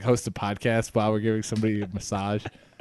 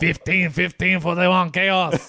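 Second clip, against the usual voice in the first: silly clown; 6 semitones higher than usual. Pretend. Mock phone-number jingle.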